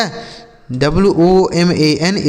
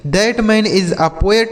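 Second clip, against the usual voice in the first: slow example reading; 2 semitones higher, much slower than usual. Shown as a demonstration. Teacher repeats example sentence slowly.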